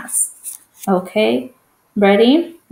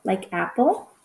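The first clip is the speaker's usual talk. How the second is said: ordinary talk, nothing marked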